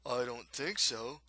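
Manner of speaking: slow character voice; slow, doubtful thug reply; monster hunter replying. A narrator's reading. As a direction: answer slowly, like a storybook thug. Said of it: "Another one responds".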